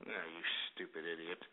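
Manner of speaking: very low, hushed insult; disgusted mutter. Real, not acted. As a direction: mutter it under your breath. Disgusted reply to offensive caller.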